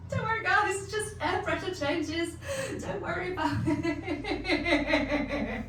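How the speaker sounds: high, slow, put-on soothing voice; a mock reassuring squeak; fake calm. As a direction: pretend to be calm while panicking. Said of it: "And then there was me".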